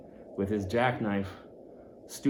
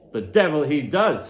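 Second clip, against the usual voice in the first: exclaiming; 4 semitones higher, 6 dB louder than usual. Acted. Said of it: "says I".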